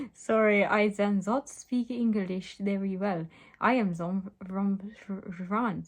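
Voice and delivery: hammed-up broken English with French accent. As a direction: stumble through it in a bad French accent. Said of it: "tried to make him French".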